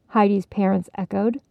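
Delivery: plain reading voice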